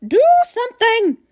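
high, loud demand; frantic, exasperated cry; screaming. A narrator's reading. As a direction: shriek it at the husband. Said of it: "Missus Gloop screamed".

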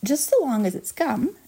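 mild, high-pitched acted shout; half-strength shout from an eager girl. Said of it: "shouted Violet Beauregarde".

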